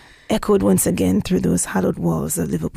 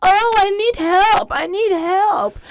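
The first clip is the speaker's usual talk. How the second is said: high, slow, mocking sing-song; sarcastic, patronizing; 11 semitones higher, 4 dB louder, slower than usual. Teller mocks the sarcastic woman at the station, high and slow. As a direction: mimic her sneering fake helplessness. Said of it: "very sarcastic and very patronizing".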